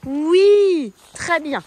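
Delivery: oui lancé très fort et haut; encouragement vif. Real, not acted. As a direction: pousser la jument à l'effort. Encourage la jument dans la pente. (la cavalière encourage sa jument dans la pente, avec élan)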